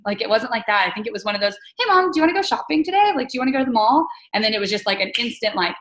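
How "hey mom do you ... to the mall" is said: much higher, bright and fast asking; chirpy teen asking mom, quick; a teen cheerfully invites mom to the mall, eager and quick; eager sweet ask. Retold or imitated.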